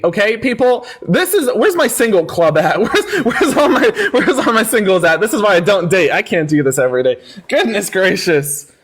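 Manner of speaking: ordinary talk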